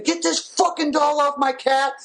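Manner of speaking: a high-pitched mock scream at the owner; screaming at dog owner. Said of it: "I'm screaming".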